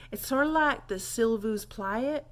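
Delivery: ordinary delivery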